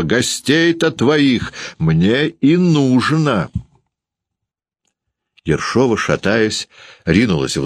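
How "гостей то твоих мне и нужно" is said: high, drawn-out defiant cry; shouting. A narrator's reading. Landlady demanding to see the guests, defiant high shout. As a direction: call out slowly on a high pitch. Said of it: "закричала Ершова".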